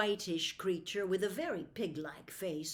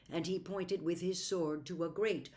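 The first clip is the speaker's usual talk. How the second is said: ordinary delivery, nothing marked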